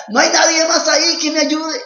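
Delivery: high, loud exasperated yell; desperate shout. Acted. Young man clinging to the branch yells in desperation. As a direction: shout it out in panic and disbelief. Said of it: "y grita".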